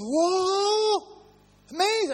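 high, amazed exclamation; awestruck Peter. Retold or imitated. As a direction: gasp it out in wide-eyed wonder. Peter awed at the transfiguration.

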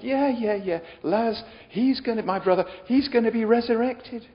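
high-pitched, brushing-off "yeah, yeah"; impatient acted reply. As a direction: play a sister who thinks she already knows. Acting out impatient sister's reply.

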